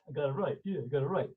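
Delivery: usual speaking voice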